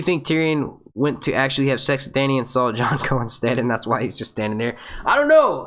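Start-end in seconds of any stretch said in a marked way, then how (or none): 5.1-5.6 amused disbelief. Real, not acted. Amused reaction to cheeky question.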